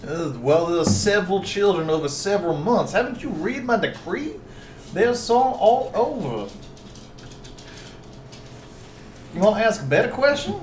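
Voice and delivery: slurry, swooping southern voice; southern accent. Acted. Magistrate answering questions about missing children, southern accent. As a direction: play a put-upon southern magistrate. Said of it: "Stop doing the southern accent".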